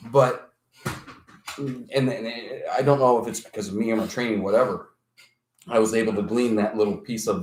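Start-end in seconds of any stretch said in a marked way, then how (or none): none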